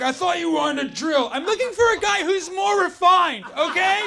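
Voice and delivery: a hint of an offended woman; voicing app users. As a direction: play the affronted dater. Voicing sleazy guy and girl.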